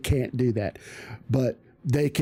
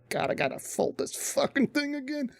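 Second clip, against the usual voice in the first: whiny complaint; 11 semitones higher, 7 dB quieter than usual. Retold or imitated. Exasperated inner complaint.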